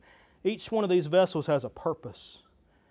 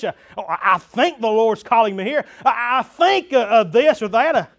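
loud, high, impassioned sermon; full-force preaching; 5 semitones higher, 9 dB louder than usual. Real, not acted. Pastor testifying he knew his calling, full-force preaching. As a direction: drive each phrase out to the whole congregation.

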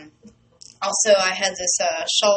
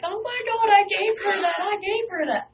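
much higher, squealing with excitement; losing my mind; 13 semitones higher than usual. Retold or imitated. Seeing the singer in her shawl, retelling an ecstatic squeal. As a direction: squeal like the star wore your gift. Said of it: "lost my mind".

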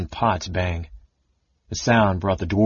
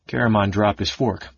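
ordinary delivery

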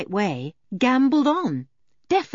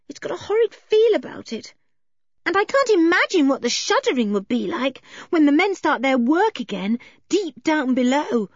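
put-on high-pitched, worried little girl; high, fretful character voice; anxious Anne; 7 semitones higher than usual. A narrator's reading. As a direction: plead against it in a high voice.

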